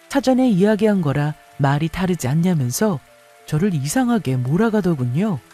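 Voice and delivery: ordinary delivery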